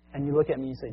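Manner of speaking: ordinary talk, nothing marked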